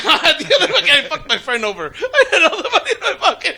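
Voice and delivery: gleeful high laughter while talking; laughing. Real, not acted. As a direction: crack up at your own trick. Gleeful about cheating his friend.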